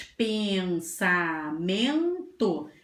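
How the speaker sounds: "Pensamento" very slow, "peen-saa-men-to"; syllable by syllable. Shown as a demonstration. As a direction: recap "Pensamento" in four stretched beats. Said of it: "quatro sílabas".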